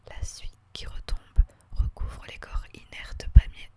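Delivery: ordinary delivery, nothing marked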